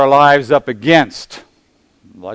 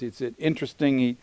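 ordinary talk

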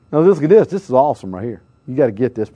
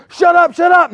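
crowd shouting down; 12 semitones higher, 3 dB louder than usual. Retold or imitated. Crowd silencing beggar.

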